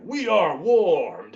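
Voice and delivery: loud, slow, stretched-out declaration; drawn-out hype. Shown as a demonstration. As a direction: announce it big and slow. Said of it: "I'm like".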